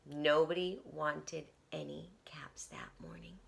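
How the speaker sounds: hushed whisper. A narrator's reading. Storyteller whispers the sad news to children. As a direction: drop to a whisper for the listeners.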